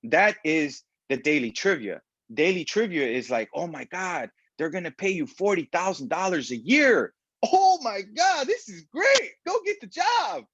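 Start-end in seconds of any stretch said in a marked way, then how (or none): 3.5-10.5 high, squealing, overexcited mock friend; hammed-up gushing over a job offer; mock excitement. Mocking friends who gush about a forty-thousand-dollar job, squealing high.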